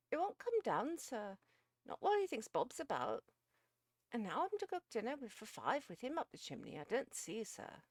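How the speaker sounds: high, loud, complaining servant woman; exasperated cook Missus Beale. A narrator's reading. Missus Beale complains to sir about the cat, exasperated.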